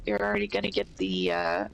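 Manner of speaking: stuffy nose voice; blocked nose. Real, not acted. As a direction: talk through a blocked-up nose. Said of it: "stuffy nose voice".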